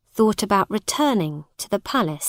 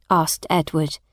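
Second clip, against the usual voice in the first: plain reading voice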